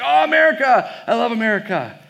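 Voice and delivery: high, loud excited squeal of delight; mock gushing. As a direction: gush like a thrilled fan. Retold waitress gushing over America.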